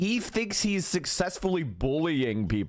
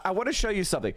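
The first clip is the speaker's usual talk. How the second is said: normal talking voice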